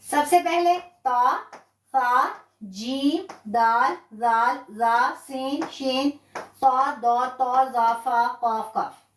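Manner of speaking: letters drawn out slowly, one at a time; slow letter recital. Shown as a demonstration. Teacher repeating the fifteen letters slowly for the class. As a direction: read each letter slowly for students to repeat. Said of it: "अब देखें".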